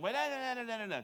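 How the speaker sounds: comic noisy-argument gibberish; nagging wife impression. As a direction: imitate a wife's jabbering in a fight. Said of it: "that's what she sounds like when we're in a fight".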